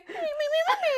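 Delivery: Muppets backing singer. Retold or imitated. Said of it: "the guy behind him going".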